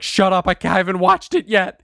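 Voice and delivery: mock spousal protest; imitating wife. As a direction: play an impatient wife cutting someone off. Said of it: "my wife was like".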